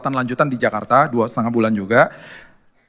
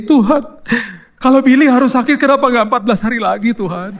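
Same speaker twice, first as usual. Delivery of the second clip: high-pitched mock wail, trailing off; imitated crying prayer; 8 semitones higher than usual. Wife pleading with God over her sick husband, mock crying. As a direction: wail it pleadingly, hammed-up sobbing. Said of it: "Nangisnya gini".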